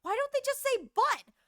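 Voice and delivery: high, puzzled mock-mom voice; mimicking mom. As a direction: daughter quoting mom's blunt question. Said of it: "She says".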